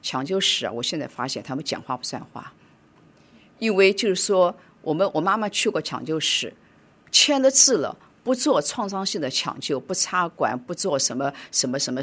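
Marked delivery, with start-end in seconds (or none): none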